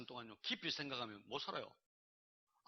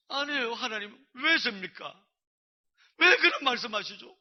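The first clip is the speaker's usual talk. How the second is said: high, loud plea to God; impassioned raised-voice refusal; acted protest; 9 semitones higher, 13 dB louder, slower than usual. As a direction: object at full voice, pleading for an explanation. Retelling his protest to God.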